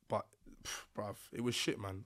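ordinary talk

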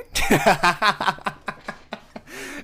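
high, loud burst of laughter; giddy, delighted cackle. Real, not acted. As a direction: laugh with glee at the compliment. Flattered by the insult.

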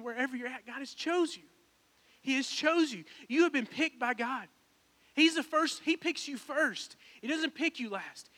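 high-pitched, urgent sermon exhortation; fervent preaching. Real, not acted. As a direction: press the promise home to the congregation. Preaching with rising fervour.

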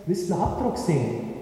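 usual speaking voice

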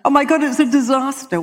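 loud, high, panicked cry of disaster; hammed-up shriek of a passenger in crisis; mock scream. Girl on the plane shrieking to the steward, mock panic. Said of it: "she screamed so loudly to the steward".

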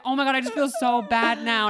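high-pitched imitation of fretting; mock guilty apology. Storyteller imitating girl who kept apologizing, high guilty gush.

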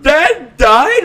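high, loud, stunned cry of Dad died; shocked disbelief. Retold or imitated. Brother hearing the news, shocked loud cry. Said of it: "I said it like that".

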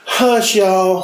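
high, teasing Southern drawl; Southern accent. Pretend. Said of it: "You do real Southern".

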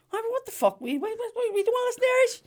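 mock outraged punter. Comic voices audience who hate the Irish show, mock outrage. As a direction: squeal it like a furious punter.

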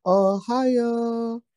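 put-on high excited anime girl Ohio. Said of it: "say it like you're a little anime girl".

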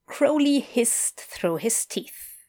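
ordinary delivery, nothing marked

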